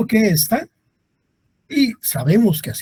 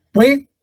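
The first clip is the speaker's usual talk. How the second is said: "Puede" with PUE high and loud, "de" apart; PUE... de, first syllable punched; stress on first syllable; 10 semitones higher, 5 dB louder than usual. Shown as a demonstration. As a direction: hit PUE hard in "Puede". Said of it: "La sílaba tónica es".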